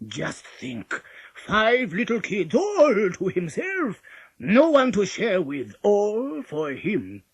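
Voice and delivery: very slow, loud greedy relish; gloating. A narrator's reading. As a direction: savour each word as a hungry wolf.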